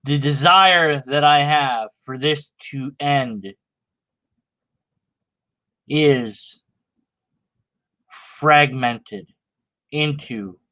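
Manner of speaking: slow, low, overwrought poetry recital; mock-solemn recital of a melodramatic poem; dramatic poem reading. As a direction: ham it up like a tortured poet.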